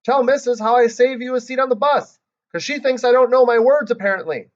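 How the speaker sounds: loud, high shout across the room. Acted. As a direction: call out across the classroom. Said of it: "I shouted across the room".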